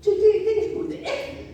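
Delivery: high, casual voice of Jesus leaning in; Jesus asking. Acted. Preacher voicing Jesus asking the disciples what's up, high and casual. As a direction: play Jesus dropping in on the argument.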